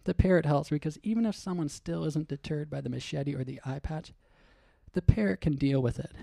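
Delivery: ordinary talk, nothing marked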